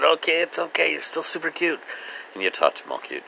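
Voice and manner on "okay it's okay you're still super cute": soothing cat. Real, not acted.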